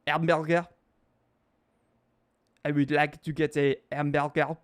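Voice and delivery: mock Frenchman struggling with English; thick French accent, dropped h and mangled r. Frenchman asked to say hamburger, thick French accent. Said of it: "Ask a Frenchman to say hamburger".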